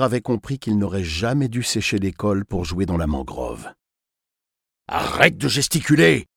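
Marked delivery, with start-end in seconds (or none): 4.9-6.3 louder, higher kidnapper's command; barked order. A narrator's reading. Kidnapper pinning the boy to the metal wall, sharp order. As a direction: order a struggling captive to keep still. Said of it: "somma son kidnappeur".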